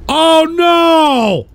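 loud, high drawn-out wail of dismay; high, loud cry of alarm; dismayed shout. Real, not acted. Reacts in dismay to the stream.

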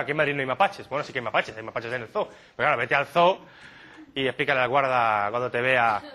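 ordinary talk, nothing marked

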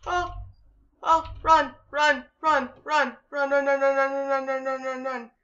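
high, loud panicked run; panicked shouting. Real, not acted. Fleeing mobs.

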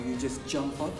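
normal talking voice

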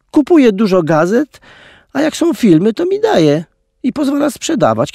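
put-on high, childlike pleading voice; innocent little girl. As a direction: play the sweet innocent child, pitched high. Girl playing innocent to guard.

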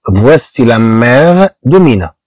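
each word of "voici le père de René" stretched out slowly; slow French model sentence. Shown as a demonstration. Teacher reading the French example slowly to students. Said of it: "ها هي أم مينا".

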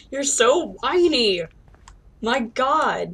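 loud, drawn-out exasperated jab. Real, not acted. Player scolding the moping game character, loudly exasperated.

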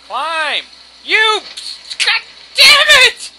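high, loud outburst building to a yell; exasperated yelling, rising to full shout; furious shout. Real, not acted. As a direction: scream in frustration at the game. Yelling at game character to climb.

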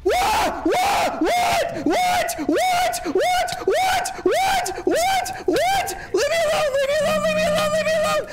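very high screamed meltdown; screaming rage. Real, not acted. Streamer screams at a game gone wrong, frantic rage.

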